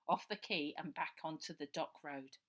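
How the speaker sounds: ordinary delivery, nothing marked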